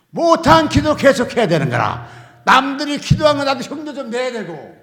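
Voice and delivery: normal talking voice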